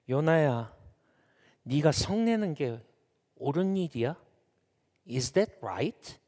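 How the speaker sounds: soft, measured reproof; God questioning Jonah. Acted. God asks Jonah about his anger, soft measured questioning. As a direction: ask gently, with long pauses. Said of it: "요나한테 이렇게 말해요".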